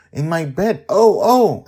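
high, loud cry of surprise; startled excitement. A narrator's reading. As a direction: yelp as she's pulled awake. Amali waking up suddenly.

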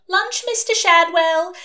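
high, loud, slow call through the door; landlady calling her lodger to lunch through the door. A narrator's reading. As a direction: sing out lunch to the lodger upstairs. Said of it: "Madam Tracy would call out".

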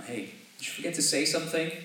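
a hint of a prodding parent; mock parent reminder. Pastor reminding his kids to say thanks, lightly voiced. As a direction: nudge the kid to say thanks. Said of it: "I kinda have to remind my kids".

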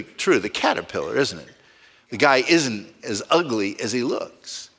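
ordinary delivery

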